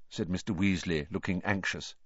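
ordinary delivery, nothing marked

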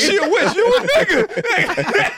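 very high, drawn-out joke accusation; mock witch accusation. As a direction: squeal the accusation in pretend outrage, stretching the end. Joking witch-trial accusation, group laughing.

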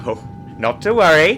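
high, loud, breezy reassurance; suave captain. Acted. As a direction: wave off the danger airily. Acted pilot sketch.

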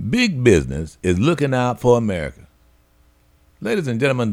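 ordinary talk

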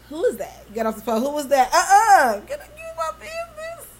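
put-on shrill nosy girlfriend grilling; mock jealous partner. Girlfriend grilling her man about his texts, mock jealous. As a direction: play the suspicious partner checking the phone.

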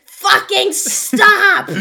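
drawn-out, high, loud mock scream; hammed-up furious shout, very slow; exaggerated mock yell. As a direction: parody yourself bellowing at a child. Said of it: "She literally made it seem like".

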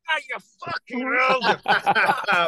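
sudden pained yelp, laughing; startled yelp. Real, not acted. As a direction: yelp as hot coffee spills. Dropped coffee all over me.

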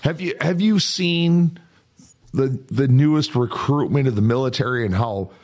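ordinary delivery

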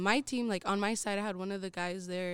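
ordinary talk

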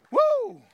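high, sharp "woo"; excited preacher whoop. Real, not acted. Preacher fired up before the congregation, excited whoop.

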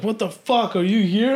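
high, incredulous, edged with laughter; retold surprise at a friend turning up; astonished, pitched well up; amazed greeting. Friend's surprise at seeing him, retold.

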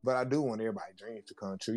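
ordinary talk, nothing marked

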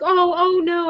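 hammed-up reassurance, high and sweet; mock comforting. Boss reassuring the comic he'd pay, mock comforting and high. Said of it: "as though he's gonna be comforting".

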